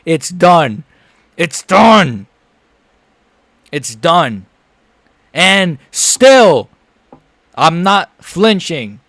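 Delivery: victory shout. Real, not acted. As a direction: celebrate like it's finally over. Celebrating the win live.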